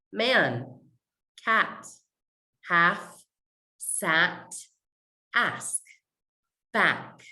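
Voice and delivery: each word alone with a nasal "a"; nasal American A. Shown as a demonstration. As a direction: model "Man, cat, half" slowly apart, vowel in the nose. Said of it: "first word I'm gonna try with you".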